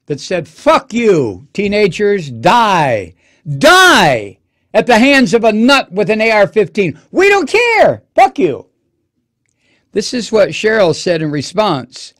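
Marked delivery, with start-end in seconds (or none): 0.5-8.7 loud, rising angry yell; mocking angry legislators. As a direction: spit it out like a furious accusation. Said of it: "she said of those seventy-one votes".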